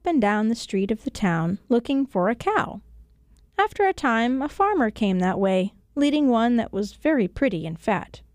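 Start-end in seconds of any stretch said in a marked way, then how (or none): none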